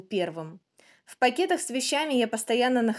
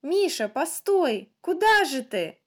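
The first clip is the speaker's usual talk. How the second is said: higher, drawn-out motherly shout; mother calling out; 7 semitones higher, slower than usual. A narrator's reading. Mother shouts after her little son running off, calling out. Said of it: "как мать кричит".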